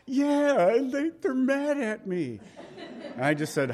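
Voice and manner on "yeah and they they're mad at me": voicing Sue. Pastor voices Sue again.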